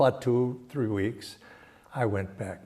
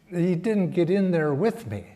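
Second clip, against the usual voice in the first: ordinary delivery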